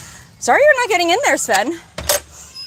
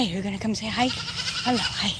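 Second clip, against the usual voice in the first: ordinary delivery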